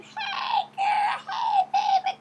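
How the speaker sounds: put-on high, loud girlfriend squeal; mock-gushing, very high-pitched hello; excited greeting. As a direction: squeal hello like greeting your best friend. Acted squealing hug greeting between girlfriends.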